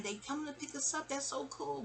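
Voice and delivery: high but soft mock call to her brother; excited near-yell. Girl about to tell her brother they're being picked up, excited. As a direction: imitate an excited kid, high and softened. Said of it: "I was about to yell at the man".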